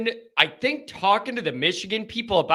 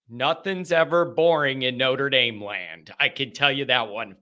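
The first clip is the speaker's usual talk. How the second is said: ordinary talk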